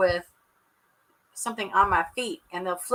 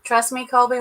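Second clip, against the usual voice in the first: ordinary talk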